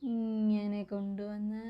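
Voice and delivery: half under the breath, drawing ഇങ്ങനെ out slowly; slow quiet murmur while writing. Real, not acted. Teacher murmurs while writing letter.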